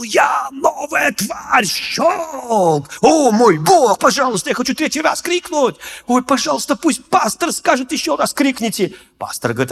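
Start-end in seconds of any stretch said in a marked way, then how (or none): 0.0-2.9 high, slow shouted «новая тварь». Retold or imitated. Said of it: "я громче всех заорал". 3.0-8.9 high, eager begging; eager pleading. Retold or imitated. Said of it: "inner pleading".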